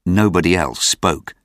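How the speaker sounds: ordinary delivery, nothing marked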